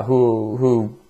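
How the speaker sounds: normal talking voice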